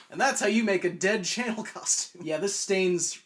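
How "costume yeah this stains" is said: ordinary delivery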